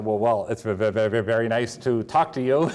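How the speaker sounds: mock nervous stammer; imitating a starstruck stutter, amused; tongue-tied. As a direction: stumble over the words like an awestruck kid. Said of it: "I wasn't glib particularly".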